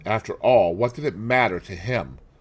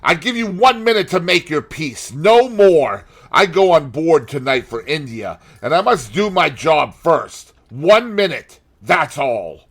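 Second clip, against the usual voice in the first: louder, higher, menacing threat; a brother swearing revenge, louder; vengeful sailor; 8 semitones higher, 8 dB louder than usual. A narrator's reading. James Vane's ultimatum.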